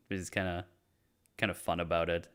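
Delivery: normal talking voice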